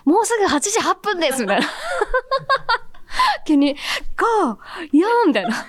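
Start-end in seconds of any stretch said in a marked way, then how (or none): 0.0-1.4 imitating the junior's perky on-air announcement, higher; a hammed-up, bright-eyed countdown host. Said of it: "後輩の上垣くんがね". 1.7-3.4 a high, rising burst of laughter; real laugh. Real, not acted. Amused at the retold countdown. 4.2-5.1 imitating the junior's countdown, higher; mock countdown. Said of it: "後輩の上垣くんがね".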